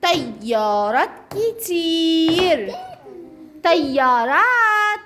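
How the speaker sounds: slow drawn out. Shown as a demonstration. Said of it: "modelling the plural".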